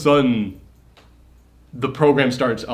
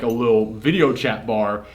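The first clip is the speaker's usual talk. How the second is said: ordinary talk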